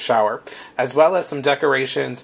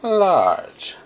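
big voice for large; 6 semitones higher than usual. Shown as a demonstration. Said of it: "you can see the scale difference".

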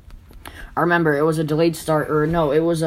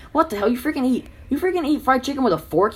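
put-on incredulous kid; 9 semitones higher than usual. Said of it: "this kid was like".

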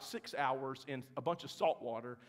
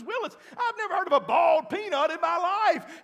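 laughing disbelief; 11 semitones higher, 9 dB louder than usual. Retold or imitated. Mom retold, laughing disbelief.

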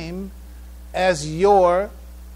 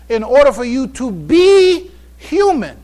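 rising, louder push on human; emphatic; 5 semitones higher, 7 dB louder than usual. Real, not acted. Preacher urging the congregation, louder emphatic push. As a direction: drive the point home to the congregation. Preacher driving home a point.

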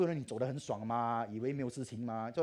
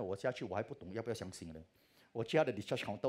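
ordinary talk

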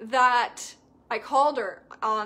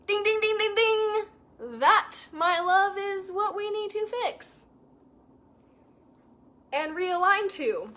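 ding-ding eureka; 8 semitones higher, 3 dB louder than usual. Retold or imitated. Coach retells her eureka call.

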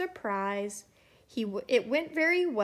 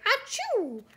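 high-pitched mock "achoo"; gigantic acted sneeze; 11 semitones higher than usual. A narrator's reading. Snowman sneezing in the winter wind, gigantic sneeze. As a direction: act out a big high sneeze. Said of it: "sneezed a gigantic".

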